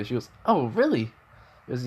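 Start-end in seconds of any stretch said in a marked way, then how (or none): 0.5-1.1 put-on light, higher girlish voice; Toga's voice. Toga greeting Midoriya in a raised girlish voice. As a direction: pitch up a bit for the girl.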